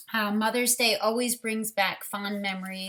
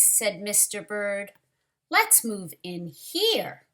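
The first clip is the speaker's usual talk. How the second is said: lively, expressive storybook reading, louder than her chat; reading to children; 7 dB louder than usual. Acted. Teacher reading a picture book to children, lively storyteller voice. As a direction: bring the picture book alive for the class.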